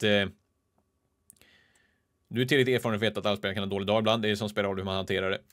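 usual speaking voice

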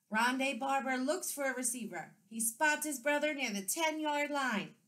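excited play-by-play announcer call; sports announcer. A narrator's reading. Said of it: "sounding like a sports announcer".

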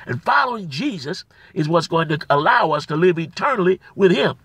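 loud, rising preacher's cry on Jesus Christ; preaching with fire. Acted. Pastor exhorting his brothers and sisters, loud preaching. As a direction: proclaim it to the back row.

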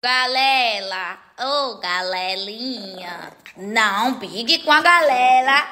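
slow, drawn-out cooing; slow doting baby talk to a little dog. Acted. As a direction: coo at the puppy like a baby.

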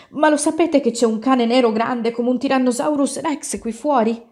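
high, urgent storybook question; alarmed warning. A narrator's reading. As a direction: raise the alarm to the household. Child raises the alarm to parents.

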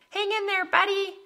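very high, chirpy faraway encouragement; mock cheerleading. Waving down from up high to someone struggling, in high mock cheer. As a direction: call down in a high, falsely cheery voice. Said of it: "waving down below and saying".

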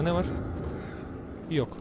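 ordinary talk, nothing marked

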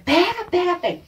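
high, loud repeated chant; crowd shouting. Retold or imitated. Retold kids all joining in, mock excited shouting. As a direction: egg on the chase like a whole crowd. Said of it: "todo mundo começou a gritar".

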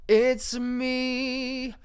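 sing-song star announcing her own spotlight; diva entrance. Pretend. Playing friend Sarah on stage, hammed-up diva. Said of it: "Sarah's gonna go".

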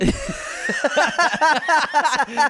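high burst of laughter. Real, not acted. Big laugh.

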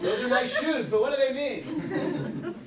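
high, loud, laughing mock puzzlement; laughing mock question. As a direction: laugh through a silly question about shoes. Mocking a silly question.

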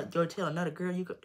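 ordinary delivery

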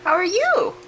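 high, loud sing-song question; pet talk. Real, not acted. Greeting the parrot again.

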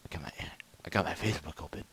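a hushed, guilty admission; breathed under the breath; sheepish whisper. Real, not acted. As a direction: whisper it, caught out. Confessing the cause of lag.

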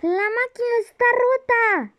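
high, excited outcry; alarmed exclaiming. Real, not acted. Alarmed reaction to broken machine.

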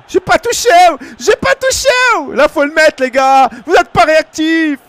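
high, loud and indignant; outraged protest. Real, not acted. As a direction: yell at the screen that you never touched him. Protesting a foul call in game.

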